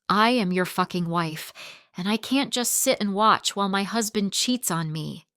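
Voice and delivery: plain reading voice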